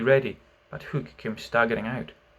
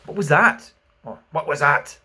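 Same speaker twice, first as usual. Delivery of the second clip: alarmed cry; 3 semitones higher, 6 dB louder than usual. A narrator's reading. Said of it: "cried Hook".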